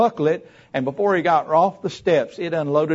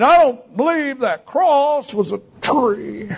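high, slow, drawn-out protest; exaggerated retold complaint; mocked objector; 11 semitones higher, 5 dB louder, slower than usual. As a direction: mimic a stubborn objector, stretching the words. Acted angry objector.

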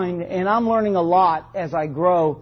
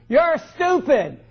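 drawn-out, higher mock scolding; higher, dragged-out insult; parent's put-down; 7 semitones higher, slower than usual. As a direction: say it slowly like a parent berating a child. Voices parent scolding children.

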